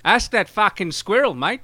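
lightly voiced mock Australian bloke. Mocking the vagrant's alibi in a light Australian accent. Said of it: "We're doing these accents".

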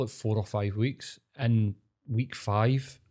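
usual speaking voice

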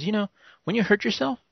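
retelling the bit in his voice; imitating comedian. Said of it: "He's over there going".

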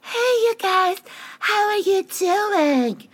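very high, drawn-out mock greeting; exaggerated high-pitched impression; Whitney. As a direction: squeal it in a put-on high voice. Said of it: "So Whitney sits down. She's like".

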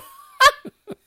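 loud, high burst of laughter; helpless laugh. Real, not acted. Learner laughing at their own flood of emails, high loud laugh.

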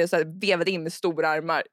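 ordinary delivery

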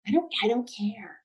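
soft, breathy whisper. Shown as a demonstration. Co-host echoing the quote, whispered. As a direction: breathe the words out with no voice. Podcast hosts recapping a dance episode.

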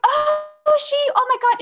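alarmed call to the patient sliding off; panicked. Retold or imitated. Panic seeing patient slipping off.

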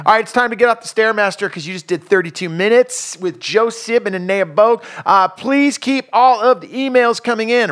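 high, punchy announcer delivery; radio host outro. Real, not acted. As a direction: project it like a radio sign-off read.

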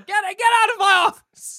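high, shouting "get out of my office"; furious boss shout. Pretend. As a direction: bark them out of the office. Boss throwing someone out.

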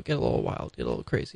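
a sudden deep voice for the wild side; very low voice. Pretend. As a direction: drop to a very low growl.

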